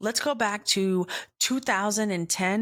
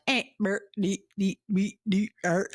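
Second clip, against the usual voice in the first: mumbled nonsense. Shown as a demonstration. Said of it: "I just talk like".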